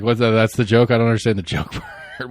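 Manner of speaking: usual speaking voice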